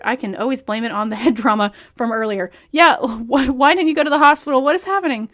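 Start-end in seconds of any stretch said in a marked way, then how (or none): 2.7-5.3 loud disbelieving; exasperated. Real, not acted. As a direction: shout it in disbelief. Exasperated outburst at character.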